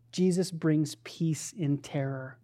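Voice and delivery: normal talking voice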